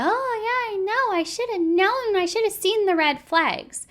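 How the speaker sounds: high, giggly airhead voice, mocking; mock dumb blonde. Mocking Meg's fake regret as a ditzy blonde. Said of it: "playing this dumb blonde".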